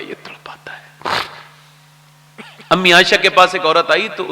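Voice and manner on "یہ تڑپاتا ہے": hushed whispered aside. Retold or imitated. Preacher voicing the grieving mother, whispered. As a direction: breathe it out like a secret grief. Retelling old mother's complaint.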